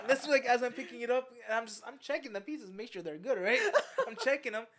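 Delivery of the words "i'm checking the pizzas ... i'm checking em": usual speaking voice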